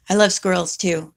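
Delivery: usual speaking voice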